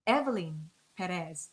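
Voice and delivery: ordinary talk, nothing marked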